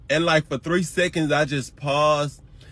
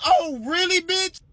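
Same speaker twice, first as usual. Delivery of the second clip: sarcastic retort; 3 dB louder than usual. Retold or imitated. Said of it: "I'm like".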